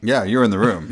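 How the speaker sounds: ordinary talk, nothing marked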